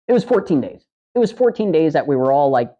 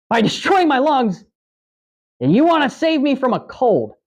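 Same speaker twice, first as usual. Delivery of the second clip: pitched up high, incredulous and arguing; retelling his outraged comeback to the tobacco clerk, high and indignant; indignant rant; 9 semitones higher than usual. Retold or imitated. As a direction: rail at the clerk in mock outrage. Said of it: "And I'm like".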